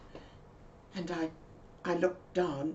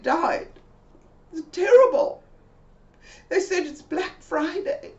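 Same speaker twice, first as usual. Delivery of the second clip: high, loud, breathless worried account; high panicked Mabel relaying the news; emotional friend; 13 semitones higher, 5 dB louder than usual. Retold or imitated. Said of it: "she became quite emotional".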